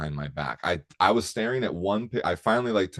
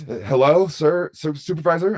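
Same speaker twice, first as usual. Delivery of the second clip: higher and louder, calling out for a supervisor; guard yelling for help; 7 semitones higher, 6 dB louder than usual. Retold or imitated. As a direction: shout across the room for backup. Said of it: "And she just like".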